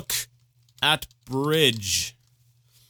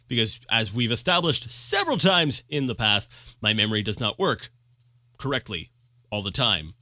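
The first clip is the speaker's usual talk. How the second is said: normal talking voice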